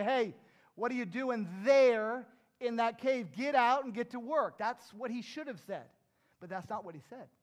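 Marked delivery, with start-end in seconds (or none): 0.0-4.6 calling down from heaven. Acted. God calls down to Elijah in the cave, loud and demanding. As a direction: holler it down like a scolding boss. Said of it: "calling down to him and say".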